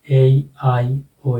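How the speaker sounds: drawn-out ए आई ओ, each vowel stretched; slow sounding-out for the class; very slow demo. Shown as a demonstration. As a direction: sound out ए आई ओ very slowly. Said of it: "लिख ही देता हूँ".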